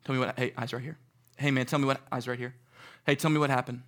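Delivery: soft, gentle coaxing; calming kid. Retold or imitated. Counselor soothing a crying camper, softly. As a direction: kneel and reassure the child.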